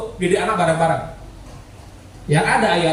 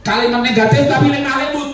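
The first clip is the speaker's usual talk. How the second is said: emphatic announcement; 7 semitones higher than usual. Real, not acted.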